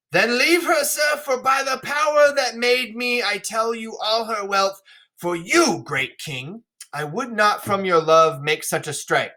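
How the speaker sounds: high theatrical royal declaiming; stagey king swearing by his maker; King Lear dismissive. Acted. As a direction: start high and imperious, then ease down. Reads Lear's retort aloud.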